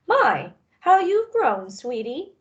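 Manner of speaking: high, slow doting coo; fond granny. Acted. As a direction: dote on her like a grandmother.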